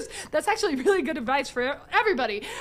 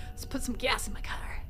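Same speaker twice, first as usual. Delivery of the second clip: unvoiced whisper; soft, breathless whisper; 3 semitones lower, 6 dB quieter than usual. Real, not acted. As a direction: say it under your breath. Whispered aside.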